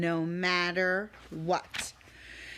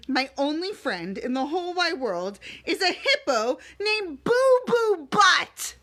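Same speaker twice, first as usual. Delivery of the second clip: giggling read; 7 semitones higher, 4 dB louder than usual. Acted. Reading the silly line.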